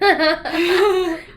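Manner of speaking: big burst of laughter; loud laugh. Real, not acted. Laughs at the joke.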